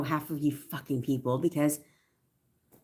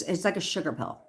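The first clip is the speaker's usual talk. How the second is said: usual speaking voice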